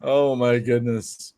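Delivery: slow words through laughter; laughing. Real, not acted. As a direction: laugh it out, slowly.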